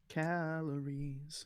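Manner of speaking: slow drawn-out. Pretend. Drawn-out joke word.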